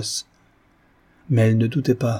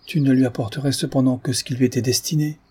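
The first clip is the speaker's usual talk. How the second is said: ordinary delivery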